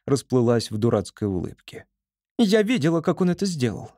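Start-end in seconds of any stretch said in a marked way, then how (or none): 2.4-3.9 shrill squirrel cry. A narrator's reading. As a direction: cry out high and breathless. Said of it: "закричала Поня".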